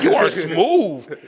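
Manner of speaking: slow, drawn-out praise; slow admiring. Retold or imitated. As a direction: savour each word. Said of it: "I was like".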